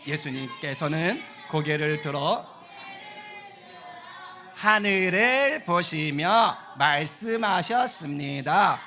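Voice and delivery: very slow, loud scripture reading; loud group reading. Shown as a demonstration. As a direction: read the verse out slowly together. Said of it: "큰 소리로".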